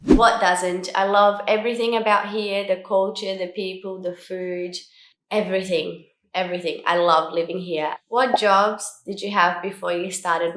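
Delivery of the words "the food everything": normal talking voice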